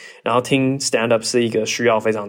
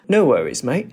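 high, hammed-up polite English voice; mock well-mannered Brit; overly polite British accent; posh British accent; 10 semitones higher than usual. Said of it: "他们一定会".

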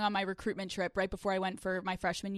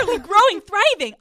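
excited brag; 10 semitones higher, 12 dB louder than usual. Real, not acted. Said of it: "Are you growing?".

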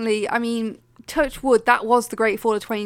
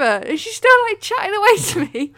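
normal talking voice

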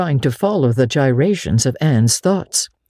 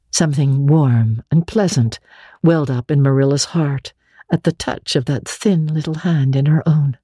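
ordinary delivery

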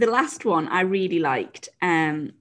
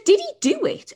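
much higher, louder disbelieving question; incredulous question; 4 dB louder than usual. Retold or imitated. Coach asking if the horse managed it, much higher disbelieving. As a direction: ask in shocked disbelief. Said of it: "She said".

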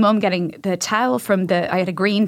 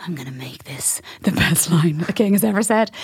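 determined whisper; 3 semitones lower than usual. Retold or imitated. Child vowing best line.

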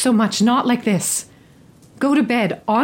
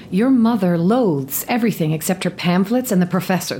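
ordinary talk, nothing marked

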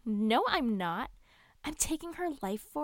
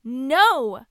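loud offended protest; indignant shout; 4 semitones higher, 11 dB louder than usual. Acted. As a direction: shout it, insulted.

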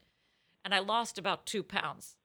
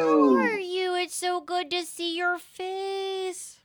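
Lisa Rinna; 11 semitones higher, 5 dB louder, slower than usual. Retold or imitated.

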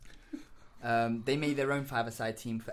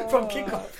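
laughing; 11 semitones higher, 10 dB louder than usual. Real, not acted. Laughing over own goal story.

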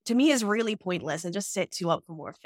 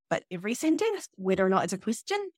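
sentence, question both rise at the end; rising end tune. Shown as a demonstration. Showing the New Zealand habit, ends rising. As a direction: end each phrase on an upturn. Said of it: "goes up at the end".

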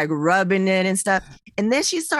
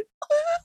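high, muffled mock sob; stifled bawling; 3 dB quieter than usual. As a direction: act out crying while holding it in. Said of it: "I'm just bawling, like, but trying to keep it together".

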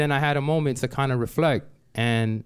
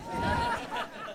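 scoffing laugh; 8 dB quieter than usual. Real, not acted. Scoffing at the boss's promise.

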